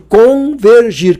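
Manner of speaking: Convergir drawn out slowly, each syllable weighted; slow emphatic definition. Shown as a demonstration. Teacher to students, defining the term, slow and emphatic. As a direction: spell out Convergir slowly for the class. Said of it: "que que é ao encontro?".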